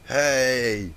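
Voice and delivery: high, loud "Hey!"; loud drunk greeting. A narrator's reading. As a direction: call it out again, tipsy. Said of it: "she said again".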